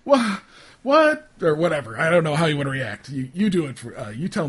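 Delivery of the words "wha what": higher, louder yelps of surprise; acted startled stammer; startled recoil. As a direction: recoil in alarm, voice jumping up. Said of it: "you recoil and you're".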